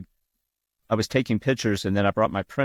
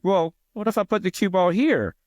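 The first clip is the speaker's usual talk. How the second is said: mock challenge; 10 semitones higher than usual. Retold challenge to bar player.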